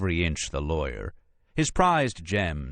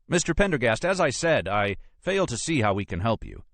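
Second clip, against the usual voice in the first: high, exasperated lawyer voice; Brisbane, impatient; 6 semitones higher than usual. Acted. Lawyer repeats his refusal to the agent, high and impatient. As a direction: start well up in pitch, out of patience.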